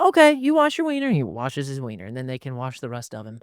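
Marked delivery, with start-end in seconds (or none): 0.0-1.1 cheerful grandparent. Retold or imitated. Grandparent voiced.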